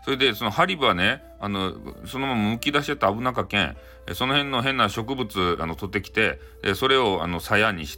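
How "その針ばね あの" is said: ordinary delivery, nothing marked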